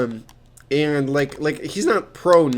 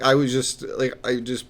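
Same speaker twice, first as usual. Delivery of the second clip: ordinary talk, nothing marked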